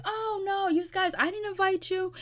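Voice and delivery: high squeaky imitation of Ray; mock high Ray. Vlogger mimics Ray objecting to gatecrashers, high mock voice. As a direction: squeal it like a fussy host.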